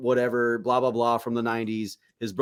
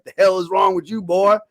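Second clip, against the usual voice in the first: mock angry scolding; 8 semitones higher, 6 dB louder than usual. Scout leader scolding in retold story.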